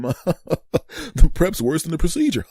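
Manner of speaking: high, loud line breaking into cackles; Joker-like laughing. Acted. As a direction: laugh maniacally through the words. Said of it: "he was laughing like the damn Joker".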